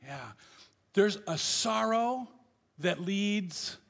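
usual speaking voice

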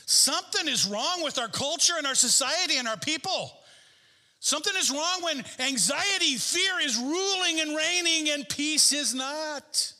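high, forceful sermon cry; full-force preaching. Real, not acted. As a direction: drive the warning home to the congregation.

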